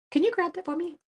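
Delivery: sweet high request. Retold or imitated. Acted request to husband.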